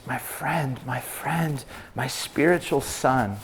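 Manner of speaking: soft, slow, fatherly address; tender appeal. Retold or imitated. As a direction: gently plead with a dear protégé. Voices Paul tenderly addressing Timothy.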